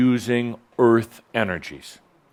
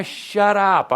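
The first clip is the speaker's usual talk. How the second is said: higher, drawn-out outburst; mock exasperated yell; 9 semitones higher than usual. Teacher to the voice in the head, mock exasperated yell. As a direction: cut the nagging voice off, high and slow. Cuts off the inner voice.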